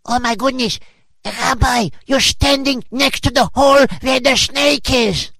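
rising panicked shout. Acted. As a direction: alarm climbing to a shriek. Onlooker panicking at the snake.

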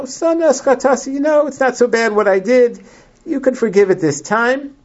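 a light imitation of a king making excuses; wheedling excuse. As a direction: talk the prophet round, lightly acted. Shaul excusing his sin.

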